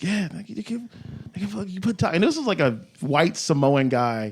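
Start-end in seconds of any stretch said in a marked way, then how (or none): 0.0-2.1 much softer, pitched higher; imitated hushed insistence. Yogurt shop dude insisting to a customer, imitated hushed insistence. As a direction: press the point under your breath, imitating.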